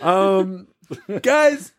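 high, squeaky through laughter; can barely get words out for laughing; laughing fit. Real, not acted. Laughing fit after joke.

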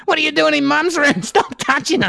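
high, put-on kid protest; indignant child. Child catching Santa in Mum's room, mock indignant. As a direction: protest like an upset kid, played for laughs.

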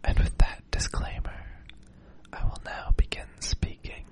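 slow, fully whispered disclaimer; ASMR whisper. Acted. Host giving listeners a disclaimer before normal speech, whispered. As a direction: whisper it slowly, close and hushed.

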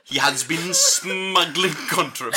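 put-on Welsh warden, long pauses; lilting, over-articulated Welsh; Welsh accent. Warden justifies a beating to prisoners, Welsh accent. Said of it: "a Welsh accent".

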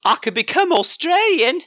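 high-pitched botched Australian accent imitation; terrible copycat accent. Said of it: "a terrible attempt at copying you".

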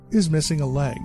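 usual speaking voice

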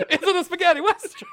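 hammed-up Italian accent, pitched well up and laughing through it. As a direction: redo the Italian accent while cracking up. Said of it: "in an offensive Italian accent".

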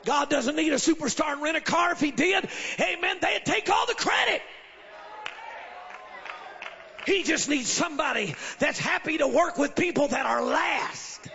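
loud, high, shouted preaching; rally the church, full voice; booming motivational speech. Real, not acted.

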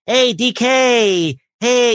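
very high, very slow squeaky greeting; weird little voice. Retold or imitated. Greeting a voice actor friend, very high slow silly voice. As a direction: stretch it out in a tiny silly voice. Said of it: "some kind of weird little voice".

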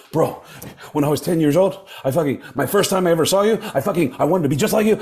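high, fast starstruck gush; overexcited fan. Retold or imitated. Chavo gushing to Gene about his childhood, high and fast. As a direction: blurt it out like a kid meeting his hero. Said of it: "Chavo's like".

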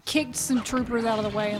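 usual speaking voice

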